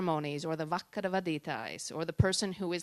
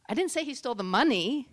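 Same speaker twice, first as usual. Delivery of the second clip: "money" punched louder and higher at the end; stress on MONEY; 4 semitones higher, 5 dB louder than usual. Shown as a demonstration. Coach shows emphasis to an audience, stress on "money". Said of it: "Certain emphasis on certain words".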